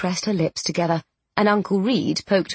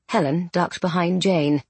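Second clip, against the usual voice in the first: usual speaking voice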